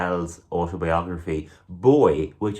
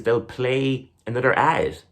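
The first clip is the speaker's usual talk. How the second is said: usual speaking voice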